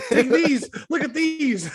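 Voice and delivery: put-on high, taunting Canadian accent; Shorzy Canadian accent, laughing. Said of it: "Canadian accent without slipping into Shorzy".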